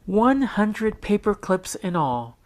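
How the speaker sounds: put-on high, proud girl voice announcing; announcing schoolgirl. A narrator's reading. Sharon to Mister Martin: high proud announcing girl. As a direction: show off a finished pile. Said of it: "Sharon announced".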